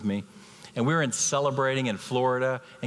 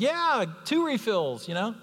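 much higher and slow, milking it; high slow mock demand to the waiter; mock greedy customer; 12 semitones higher, slower than usual. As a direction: play the pampered customer, hammed up. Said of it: "I'm like".

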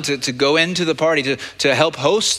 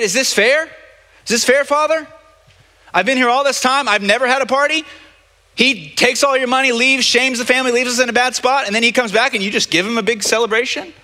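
much higher, a pleading, indignant whine; high whining protest; 10 semitones higher, 3 dB louder than usual. Retold or imitated. Older son protesting to his father that it's unfair, high indignant whine. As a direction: protest like a wronged child, pitched way up.

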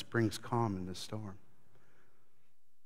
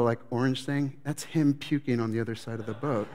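ordinary talk, nothing marked

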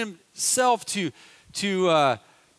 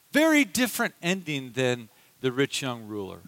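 ordinary talk

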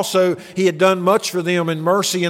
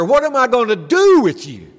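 higher, exasperated mother's sigh of a question; exasperated mom; 6 semitones higher than usual. Retold or imitated. Preacher imitating his mother scolding him, exasperated. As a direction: mimic a fed-up mother, pitched up. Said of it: "her parental exasperation".

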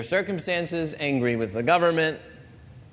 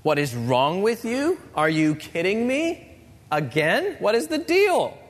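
exasperated inner complaint. Acted. Preacher voices the congregation's unspoken angry thoughts, exasperated. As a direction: act out fed-up, indignant thoughts, building to a peak. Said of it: "your thoughts at some point went, went something like this".

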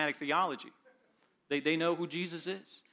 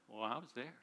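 smug devil; 4 semitones lower, 12 dB quieter than usual. Acted. Devil's smug answer about the resurrection.

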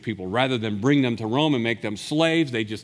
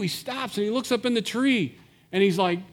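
ordinary talk, nothing marked

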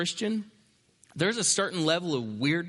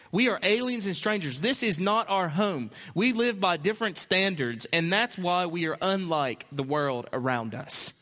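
ordinary delivery

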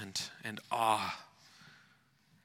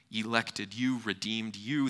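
ordinary delivery

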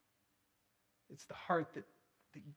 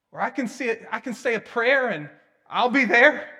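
glib; 8 semitones higher, 16 dB louder than usual. Retold or imitated. Mocking a casual prayer-sayer.

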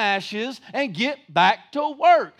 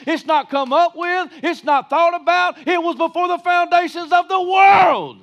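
builds from firm to a shouted climax; preaching at full force; 5 semitones higher, 5 dB louder than usual. Acted. Preacher rallying the congregation, building to a shout. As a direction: preach it louder and louder to the end.

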